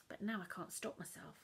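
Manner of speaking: ordinary delivery, nothing marked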